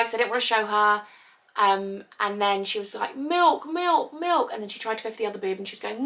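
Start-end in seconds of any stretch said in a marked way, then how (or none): 3.2-4.5 put-on high toddler calling milk; toddler asking. Toddler asking mum for milk, high little voice.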